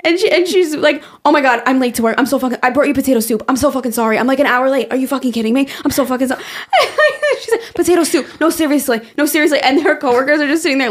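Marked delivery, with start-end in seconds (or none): 1.2-6.4 hammed-up, rattled Long Island gab; Long Island accent. Podcast host imitating worker late with soup, hammed-up Long Island accent. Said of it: "the most, like, Long Island people ever". 7.7-9.6 higher, mock-earnest Long Island voice; Long Island accent. Podcast host imitating worker insisting to coworkers, higher Long Island accent. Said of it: "the most, like, Long Island people ever".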